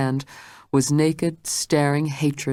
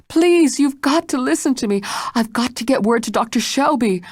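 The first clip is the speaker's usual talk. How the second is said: high, loud desperate begging; desperate wailing plea; 9 semitones higher, 3 dB louder than usual. A narrator's reading. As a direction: plead in rising despair. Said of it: "Blanche wailed".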